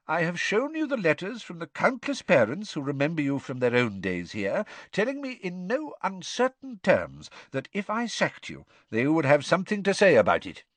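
lightly voiced calm old headmaster; a hint of gentle, wry old wizard; Dumbledore's voice.

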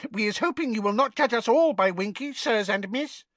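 high, sheepish house elf; ashamed elf squeak. A narrator's reading. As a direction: squeak it out apologetic. Elf apologising.

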